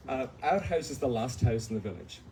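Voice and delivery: ordinary talk, nothing marked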